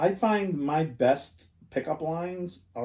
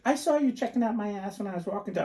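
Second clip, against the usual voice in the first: quoting ex-wife; 8 semitones higher, faster than usual. Retold or imitated. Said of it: "she's like".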